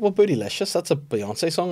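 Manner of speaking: quick justification. Retold or imitated. Mum justifying a word to herself. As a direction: tell yourself it's fine. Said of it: "And I was like".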